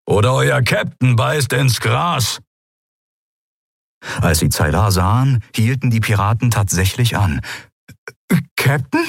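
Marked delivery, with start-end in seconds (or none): 0.1-2.4 higher, slow creature grumble; menacing grumble. Acted. Keru threatening the pirates, slow menacing grumble. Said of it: "brummte er und drohte". 8.6-9.1 a higher, rising gasp of Captain; Captain squeezed out in disbelief, rising; stunned question. Acted. Zaylar reacting to her crew, startled Captain. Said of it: "brachte Zaylar hervor".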